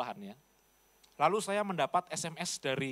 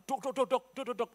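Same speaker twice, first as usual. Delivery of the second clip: rapid higher knocking sounds "duk"; imitated knocking; 7 semitones higher than usual. Preacher mimicking a villager banging on his car, knock knock.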